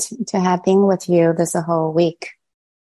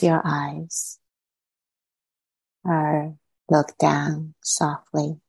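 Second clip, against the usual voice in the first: guided meditation. Acted. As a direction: ease listeners inward, unhurried.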